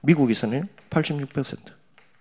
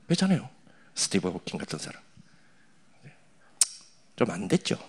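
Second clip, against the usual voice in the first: usual speaking voice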